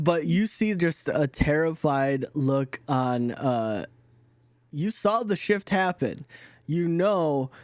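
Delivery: usual speaking voice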